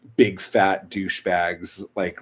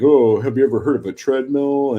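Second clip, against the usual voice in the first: louder, snide mocking jab; mock troll taunt; 3 semitones higher, 7 dB louder than usual. Sister imitates brother trolling people's pictures, mocking taunt. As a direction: imitate an online troll's sneering question.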